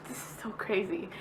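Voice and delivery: whispered, choked up with emotion; emotional whisper. Real, not acted. Vlogging about her coming baby, near tears, whispered. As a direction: whisper it, fighting back happy tears. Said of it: "I'm getting so emotional".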